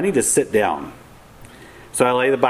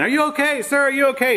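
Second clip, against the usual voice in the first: retold yelling; 14 semitones higher, faster than usual. Race volunteer calling to a fallen rider, high mock yell. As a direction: call out while running down the hill. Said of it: "the whole way she's yelling".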